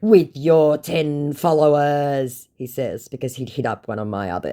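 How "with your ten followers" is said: high, loud, drawn-out sneer; slow put-on scammer taunt; mock scammer. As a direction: stretch it mockingly as the scammer. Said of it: "he goes".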